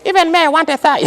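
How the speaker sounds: high, small mock voice behind you. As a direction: squeak it in a tiny high voice. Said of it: "a small voice behind you".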